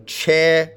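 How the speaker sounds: che punched as a lone syllable; che said by itself, strongly stressed; stressed first syllable. Shown as a demonstration. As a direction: give che full stress, cut off from the rest. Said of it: "with a stress on the first syllable".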